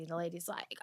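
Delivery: ordinary talk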